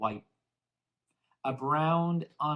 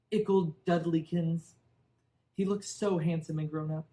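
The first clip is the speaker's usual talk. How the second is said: doting mother; 6 semitones higher, 4 dB louder than usual. A narrator's reading. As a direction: coo it over her darling. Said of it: "burst into tears".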